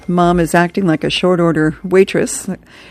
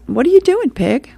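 much higher, sharper cartoon voice; Rat's question; 10 semitones higher than usual. Rat questions Pig at the table, in a high cartoon voice. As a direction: ask it like a sneering cartoon rat.

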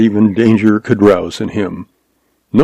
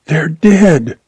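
put-on fearful young woman; 7 semitones higher than usual. A narrator's reading. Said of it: "she whispered".

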